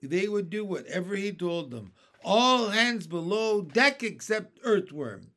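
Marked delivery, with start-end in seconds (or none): 2.2-5.3 loud captain's order. Acted. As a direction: command the crew below. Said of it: "he ordered".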